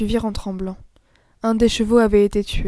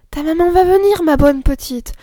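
put-on comforting girl; 6 semitones higher, 4 dB louder than usual. A narrator's reading. Camille comforts the child.

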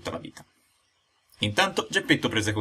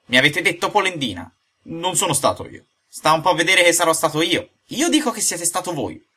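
mildly indignant, raised-pitch accusations; higher, testy bickering; heated quarrel; 7 semitones higher, 4 dB louder than usual. Acted. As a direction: argue back and forth, pitched high. Acted escalating quarrel of two men.

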